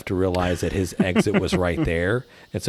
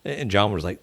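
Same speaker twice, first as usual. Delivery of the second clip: ordinary talk, nothing marked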